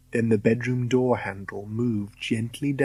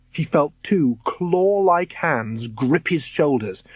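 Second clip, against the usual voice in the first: plain reading voice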